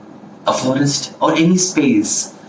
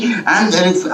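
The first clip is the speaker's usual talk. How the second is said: ordinary delivery, nothing marked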